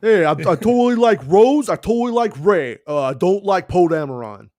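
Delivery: high, loud, defensive mock voice; mock defensive fan. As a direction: protest too much, up high.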